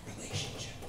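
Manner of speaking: breathy whisper, barely there; whispered punchline. Acted. Pastor whispering the answer to the congregation. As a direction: let it drop into a whisper.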